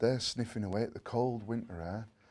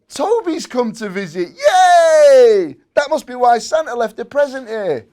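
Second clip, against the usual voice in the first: loud, much higher squealing cheer; excited shout; 11 semitones higher, 15 dB louder, slower than usual. A narrator's reading. Jimmy cheers as Toby arrives, loud and high. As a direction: burst out with delight at the door. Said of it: "Jimmy shouted".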